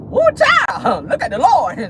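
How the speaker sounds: high delighted shout; gleeful whoop. Real, not acted. Vlogger whooping at the money news, high and gleeful.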